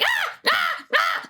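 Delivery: high mock yelp screams; very high playful dog scream; imitated dog scream. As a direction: squeal like the pug into the drain. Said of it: "he'll literally scream".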